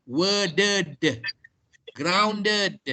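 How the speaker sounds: "worded", "grounded" with heavy voiced final D; voiced D ending. Shown as a demonstration. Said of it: "it's voiced, like, uh, for example".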